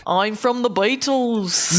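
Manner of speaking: slow, drawn-out fake British accent; mock British accent. Co-host mocks their accents as a Beatle, British accent. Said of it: "You gotta talk in Scottish".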